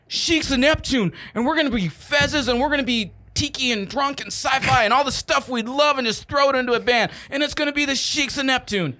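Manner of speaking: high-pitched retold eureka; re-enacted thrill of a sudden idea; excited brainwave. Re-enacts his middle-of-night brainwave.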